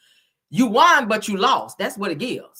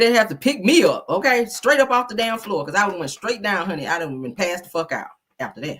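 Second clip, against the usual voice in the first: normal talking voice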